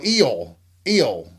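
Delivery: "eel" with a long ee and falling tune; Southern "ill". Retold or imitated. Donna repeating herself to the manager, Southern "eel" for "ill". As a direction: insist on "eel", the Southern "ill" with stretched vowel. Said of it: "Why did you have to say eel?".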